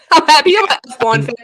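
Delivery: fast, giggling delight; bubbling over with happy laughter; laughing happily. Real, not acted. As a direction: laugh through the joy. Gushing about favourite race.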